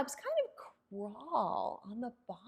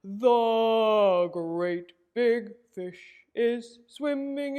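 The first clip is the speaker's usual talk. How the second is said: louder, booming big fish singing; great big voice; 7 dB louder than usual. Pretend. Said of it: "big fish with a great big voice".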